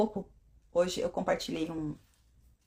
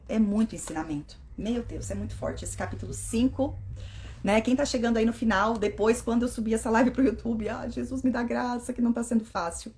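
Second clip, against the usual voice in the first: usual speaking voice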